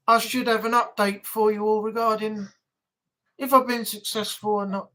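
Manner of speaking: ordinary talk, nothing marked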